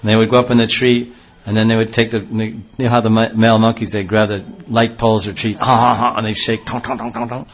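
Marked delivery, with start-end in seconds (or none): 5.6-7.5 ape a monkey rattling a pole; monkey and pole noises. Retold or imitated. Describing male monkeys shaking poles, playful imitation.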